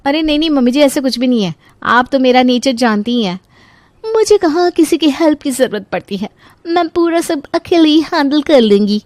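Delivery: high, sweetly boastful voice; self-assured daughter-in-law. Acted. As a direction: wave away help confidently.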